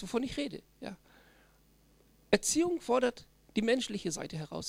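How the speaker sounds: ordinary delivery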